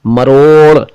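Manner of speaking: मरोड़ ending on a flapped ड़; correct flap. Shown as a demonstration. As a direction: finish मरोड़ with a tongue flick. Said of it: "एक शब्द है मरोड़".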